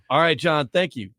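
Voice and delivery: ordinary talk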